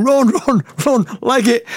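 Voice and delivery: high, urgent reenacted yell; retold group shouting. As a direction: yell it like fleeing with the gang. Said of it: "all shouting".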